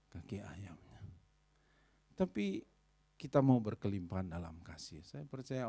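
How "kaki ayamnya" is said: hushed aside. Acted.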